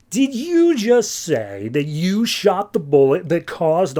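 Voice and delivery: hammed-up slow grilling; mock incredulous accusation. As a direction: stretch each word of the accusation. Forbes accusing Gordon of carnage.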